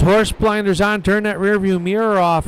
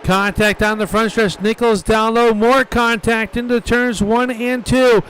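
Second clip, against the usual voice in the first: motorsport commentator; 3 semitones higher than usual. Real, not acted. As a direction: call the crash live, building fast. Live call of a crash.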